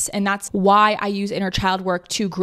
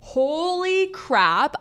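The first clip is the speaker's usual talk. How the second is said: high, very slow gasp of discovery; drawn-out mock amazement; imitating a sudden epiphany; stunned realization; 8 semitones higher, much slower than usual. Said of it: "be like".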